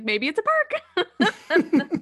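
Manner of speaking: high, loud, drawn-out cartoon impression through laughter; Donkey. Friend joking about a bonus, playful character impression. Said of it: "like Donkey".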